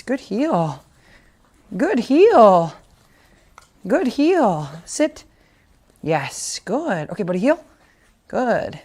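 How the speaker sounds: bright trainer voice praising a puppy; dog training voice. Real, not acted.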